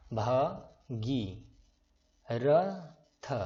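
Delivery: syllable by syllable. Shown as a demonstration. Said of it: "syllables spelled one by one".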